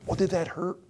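gentle dentist reassuring a nervous patient; concerned dentist. Retold or imitated. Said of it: "they're so concerned".

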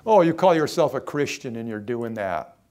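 loud sneering taunt; scornful imitation of a heckler; mocking jeer. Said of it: "they'll mock you".

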